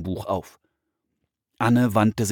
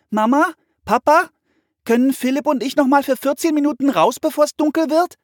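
put-on loud, very high child's call; 8 dB louder than usual. A narrator's reading. Girl calls to her parents for permission, loud high shout. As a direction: shout a request through the house. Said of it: "sagte Anne und rief".

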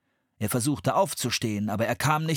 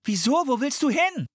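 much higher, louder question; shouted back; 13 semitones higher, 3 dB louder than usual. A narrator's reading. Anne shouts back to her brother in alarm. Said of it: "schrie Anne zurück".

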